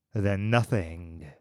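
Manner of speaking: ordinary delivery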